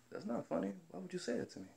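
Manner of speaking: high, very soft voice; small, high, wounded objection; retold hurt protest. Worker objecting to the manager's joke, retold soft hurt protest.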